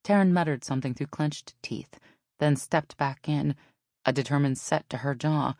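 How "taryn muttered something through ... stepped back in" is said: usual speaking voice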